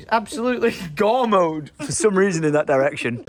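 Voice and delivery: normal talking voice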